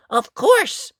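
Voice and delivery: light up with the answer; girl realising her packed lunch helps; excited realisation. Acted. Dot remembers lunch.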